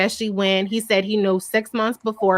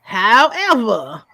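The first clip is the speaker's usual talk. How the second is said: usual speaking voice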